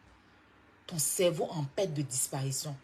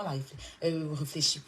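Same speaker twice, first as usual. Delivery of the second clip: ordinary talk, nothing marked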